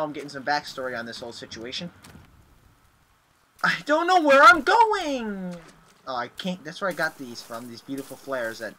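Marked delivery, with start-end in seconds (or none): 3.6-5.5 loud, high, slow drawn-out wail; desperate wail. Real, not acted. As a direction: wail it in despair, stretching words. Lost and panicking in trenches.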